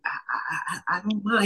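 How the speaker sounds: halting, repeated I before the words; stuttering. Retold or imitated. Cop caught off guard, answering with a mild stutter. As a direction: stumble over the first word, a bit unsure. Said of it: "He stuttered".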